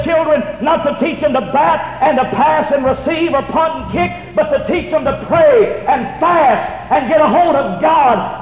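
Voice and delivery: loud, high-pitched revival preaching; fiery preaching. Acted. Preacher pleading with the church at full force. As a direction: drive it home like a revival preacher.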